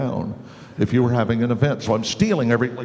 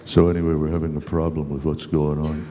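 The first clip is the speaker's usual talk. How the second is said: low voice, much lower throughout; Keith Crawford impression; 8 semitones lower than usual. Imitating Keith Crawford scolding the actor. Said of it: "Wanna hear my Crawford?".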